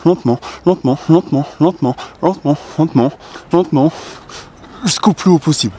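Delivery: high, rhythmic cueing under effort; pull-up tempo cue. Shown as a demonstration. Coach demonstrating the widest grip pull-up, effortful repeated cue rising in pitch. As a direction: keep the beat as the pull gets harder.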